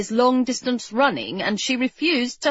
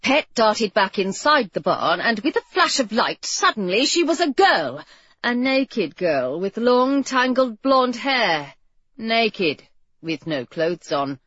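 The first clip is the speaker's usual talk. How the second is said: ordinary delivery, nothing marked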